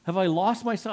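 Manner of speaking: imitated worried doubter; anxious wondering. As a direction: wonder aloud, doubting yourself. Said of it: "constantly wondering".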